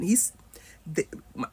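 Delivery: usual speaking voice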